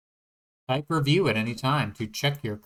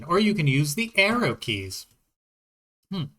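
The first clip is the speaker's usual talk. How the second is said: ordinary delivery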